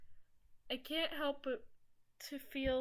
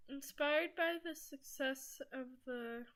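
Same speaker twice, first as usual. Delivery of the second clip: ordinary talk, nothing marked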